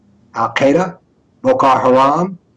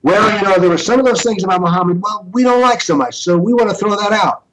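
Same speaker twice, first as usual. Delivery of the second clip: higher-pitched voice of a sheepish reformer; acted hedging in a raised voice; mock excuse-making; 7 semitones higher than usual. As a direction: imitate someone lamely explaining it away, pitched up.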